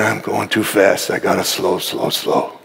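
low, soft, winding down to a near murmur; slowing down. Real, not acted. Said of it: "I gotta slow down".

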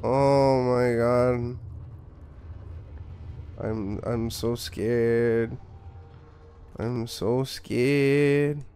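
very slow, drawn-out sarcastic fright; deadpan mock fear. Gamer mocking a horror game's scares, deadpan fake fear. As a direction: feign being scared, dragging every word out.

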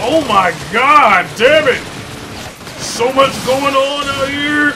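loud, high, drawn-out exasperation; overwhelmed yelling. Real, not acted. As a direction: wail loudly at the chaos around you. Gamer overwhelmed in fight.

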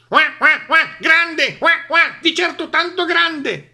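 high, loud quacking duck; agitated duck. A narrator's reading. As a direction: flap and squawk nervously. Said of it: "borbottò Papera". (Papera agitata che borbotta)

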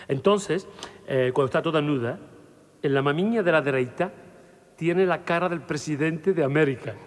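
ordinary delivery

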